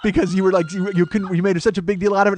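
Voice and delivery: loud, high excited friend; excited retelling. Retold or imitated. Friend explaining the gift card to comic, excited. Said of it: "I was so excited".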